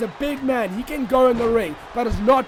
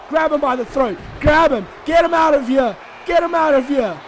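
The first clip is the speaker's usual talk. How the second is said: excited urging; 3 semitones higher than usual. Real, not acted. Commentator cheering Regal to throw Malenko out, excited shouts. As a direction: yell at the screen to throw him out.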